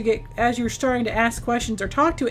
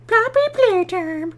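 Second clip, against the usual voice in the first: very high, stretched put-on squeal; playful cheer; 9 semitones higher, 3 dB louder, slower than usual. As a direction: announce the game in a silly high voice.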